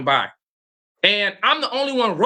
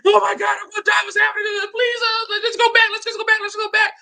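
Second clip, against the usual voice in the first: very high, pleading squeal; mock terrified pleading; 14 semitones higher, faster than usual. Husband begging his wife to paddle back, in high panicked squeals. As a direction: beg to go back like a terrified child.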